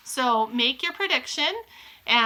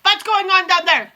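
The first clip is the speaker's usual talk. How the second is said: high, loud witch queen shout; Grand High Witch shouts down at the crowd; 9 semitones higher, 11 dB louder than usual. Acted. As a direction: demand an answer from the platform. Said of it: "shouted the Grand High Witch".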